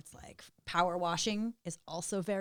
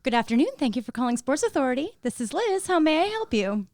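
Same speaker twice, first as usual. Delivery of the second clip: bright, high, loud phone greeting; chirpy shop assistant answering the phone; customer service voice; 6 semitones higher, 7 dB louder than usual. Acted. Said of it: "let's do a customer service voice".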